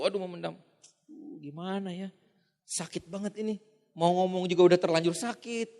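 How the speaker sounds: wounded pragmatist keeping it inside; hurt murmur. Retold or imitated. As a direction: say it to yourself, swallowing the hurt.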